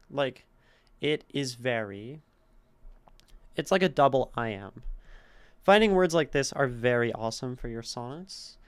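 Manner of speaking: ordinary delivery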